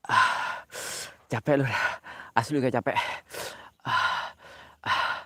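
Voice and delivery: breathless panting gasps. Real, not acted. Out of breath from the hike, panting. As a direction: pant hard after the climb. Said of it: "kok ngos-ngosan gini".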